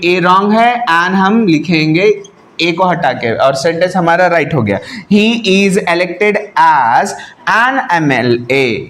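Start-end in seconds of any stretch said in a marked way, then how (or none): 5.1-8.8 very slow reading of the corrected sentence. Shown as a demonstration. Teacher reads the corrected sentence to students, slowly. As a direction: read it out slowly before dictating. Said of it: "क्या लिखेंगे?".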